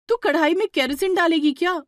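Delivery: high, loud shocked disbelief; shocked outcry. Acted. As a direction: cry out in alarm at her mistake. Divya shocked at kerosene.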